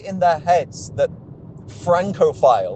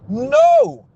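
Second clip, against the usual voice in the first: a high, loud, flat-out no; emphatic no; 6 dB louder than usual. Real, not acted. Mocking the brand's Parisian pitch, a loud outraged no. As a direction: shoot the idea down hard. Rejecting his own absurd comparison.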